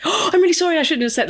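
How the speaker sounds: high, gushing sorry; apologetic friend. Retold or imitated. Friend apologising for saying old witch, high and gushing. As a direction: imitate a friend rushing to apologise.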